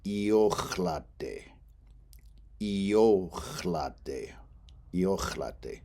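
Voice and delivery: iochlate with stress on the o and a guttural h; Lakota iochlate. Shown as a demonstration. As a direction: say iochlate slowly, io-CHLA-te with throaty h. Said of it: "that word is gonna be pronounced".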